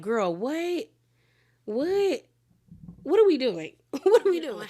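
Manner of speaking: high, baffled cries of what; mock disbelief. Friends reacting to the ashes, half-laughing surprise. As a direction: play everyone's stunned what at the absurd purse. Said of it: "So everyone's like".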